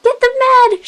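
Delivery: very high, loud cry; alarmed yell. A narrator's reading. She-cat calling help for the sick leader, yelling in alarm. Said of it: "she yelled, alarmed".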